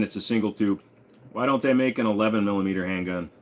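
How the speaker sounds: ordinary talk, nothing marked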